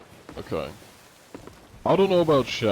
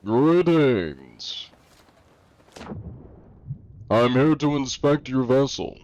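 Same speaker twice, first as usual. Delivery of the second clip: formal inspector; 4 semitones higher, 4 dB louder, slower than usual. Acted. Pirate game role greeting.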